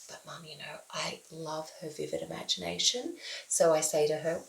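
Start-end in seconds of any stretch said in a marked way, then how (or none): 0.1-3.2 a hushed confiding whisper to Mum; whispered aside. Real, not acted. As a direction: whisper it behind her back. Aside to Mum about daughter.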